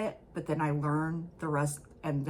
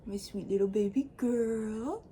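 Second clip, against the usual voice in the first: cooing to a pet; 6 semitones higher than usual. Real, not acted. Host to her cat hanging out with her, cooing.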